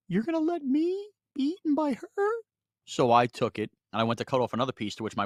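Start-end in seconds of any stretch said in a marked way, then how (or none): 0.1-2.3 very high, jealous burrito; talking burrito voice. Acted. Burrito protests the wife eating it, very high jealous voice. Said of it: "the rest of it was in the fridge going".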